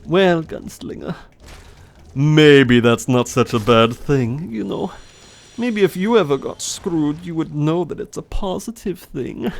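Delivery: put-on teasing German-accented character voice; lilting German accent, swooping up; Heinrich. German character teases Gunslinger back, accented and sly. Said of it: "my old German voice".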